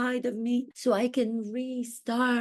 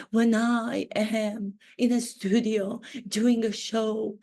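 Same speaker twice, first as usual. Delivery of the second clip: usual speaking voice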